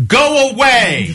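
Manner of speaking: high, drawn-out call through a door; mock yell. Homeowner to late trick-or-treater through the door, mock yell. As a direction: pretend to holler at a late caller, stretched out. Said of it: "yelled through the door".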